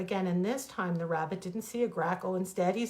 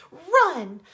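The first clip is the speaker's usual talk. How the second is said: high, much louder panicked yell; frantic shout; 9 semitones higher, 11 dB louder, slower than usual. Acted. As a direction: scream a warning to her son. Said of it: "she shouted".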